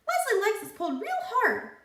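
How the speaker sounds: high, squeaky talking pigtail. A narrator's reading. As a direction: play the cheeky pigtail, way up high.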